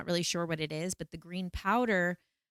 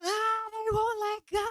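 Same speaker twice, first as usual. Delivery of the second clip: very high anguished wail; desperate cry. Retold or imitated. Breathing with the shaman, very high desperate wail. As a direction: wail it out like you're overwhelmed. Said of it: "I'd be like".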